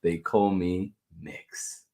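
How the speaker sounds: usual speaking voice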